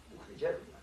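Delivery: breathy unvoiced whisper of the line; whispered aside. Shown as a demonstration. Preacher to congregation, whispering it to himself. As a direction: drop to a hush on દુઃખની જરૂર નથી. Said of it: "repeated as a softly voiced aside".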